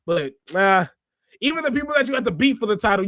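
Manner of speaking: ordinary talk, nothing marked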